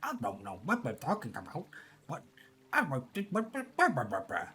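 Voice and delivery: mumbled bumpkin accent with heavy B sounds. Shown as a demonstration. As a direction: imitate a bumpkin, popping every B. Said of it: "the B is really pronounced".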